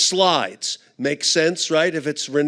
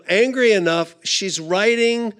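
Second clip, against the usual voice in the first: usual speaking voice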